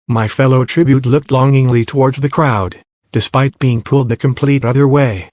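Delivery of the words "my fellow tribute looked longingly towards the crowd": ordinary delivery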